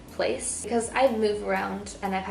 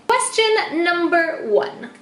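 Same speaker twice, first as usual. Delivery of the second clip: drawn-out, high game-show announce; announcer voice; 8 semitones higher, 5 dB louder, slower than usual. Acted. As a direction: call out the question like a show host. Announcing question heading.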